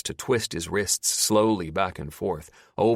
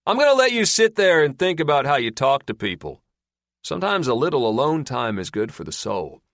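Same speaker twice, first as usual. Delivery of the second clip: louder mocking captor calling back; taunting call; 7 semitones higher, 5 dB louder than usual. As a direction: scold him from the dark.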